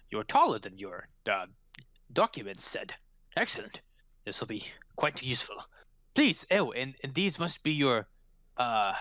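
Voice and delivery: hesitant, officious constable voice; brisk official constable. Acted. Constable sizing up the newly arrived detectives, officious and halting. Game master plays the constable.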